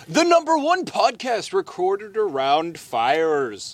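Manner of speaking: slow, grand podcast intro; mock announcer. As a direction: announce it with drawn-out pomp.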